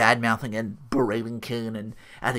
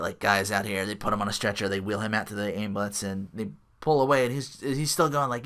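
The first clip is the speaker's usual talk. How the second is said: ordinary delivery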